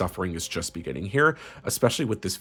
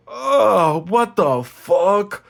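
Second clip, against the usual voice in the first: much higher and louder, drawn-out mock disgust; disgusted onlooker; 10 semitones higher, 8 dB louder, much slower than usual. People reacting to the ex's smell, mock disgust. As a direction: stretch the what the fuck in fake horror. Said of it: "people are like".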